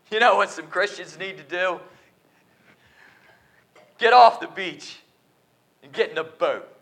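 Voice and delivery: loud, high exhortation; rally the church to get moving; preaching shout. Real, not acted. Preacher exhorting the congregation, loud and rising.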